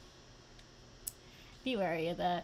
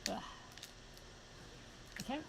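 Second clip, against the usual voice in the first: usual speaking voice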